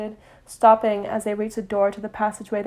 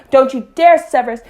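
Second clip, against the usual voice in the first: loud angry warning; 4 semitones higher, 10 dB louder than usual. A narrator's reading. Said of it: "her voice rousing the decibel".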